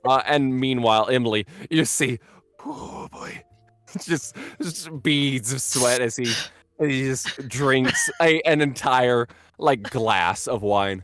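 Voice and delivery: excited narration. Acted. GM narrates the nervous host, loud.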